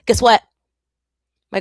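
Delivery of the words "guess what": loud, high excited hook; spring the news on them; bursting to share news; excited tease. Real, not acted. Excited announcement to viewers.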